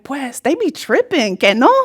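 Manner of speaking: Spanglish and Southern slang mixed, playful. Retold or imitated. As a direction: toss it off like a cheeky schoolkid. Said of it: "I'd be like".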